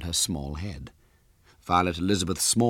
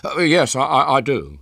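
put-on loud, higher, stammering boy; hasty; 7 semitones higher, 7 dB louder than usual. A narrator's reading. Cornered boy agrees with the girl, hasty stammer. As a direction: blurt out a flustered agreement. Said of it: "he said hastily".

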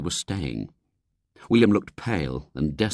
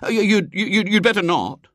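put-on stammering, higher, louder boy; desperate; 14 semitones higher, 4 dB louder than usual. A narrator's reading. William tries to deter the lady, desperate. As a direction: blurt it out to stop them. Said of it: "said William desperately".

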